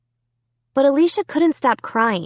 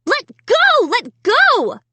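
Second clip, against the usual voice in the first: high, louder panicked shriek; 14 semitones higher, 4 dB louder, slower than usual. A narrator's reading. Girl to her dummy, high panicked shriek. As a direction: yank and scream at the dummy. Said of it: "I shrieked".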